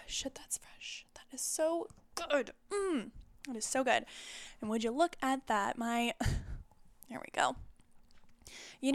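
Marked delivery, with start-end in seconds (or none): 0.1-1.1 whispered reaction after a sip; breathy hush after drinking; while sipping a drink. Real, not acted. 1.2-4.1 savoring the taste, whispered. Real, not acted. Savoring the drink.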